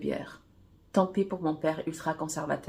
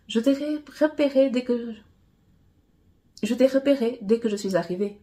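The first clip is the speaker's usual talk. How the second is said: confessing; 5 semitones higher, 4 dB louder than usual. A narrator's reading. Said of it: "m'avoue Miss Univers".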